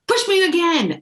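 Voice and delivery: put-on insistent kid. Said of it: "He goes".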